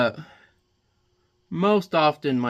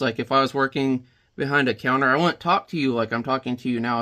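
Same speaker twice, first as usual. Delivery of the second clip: usual speaking voice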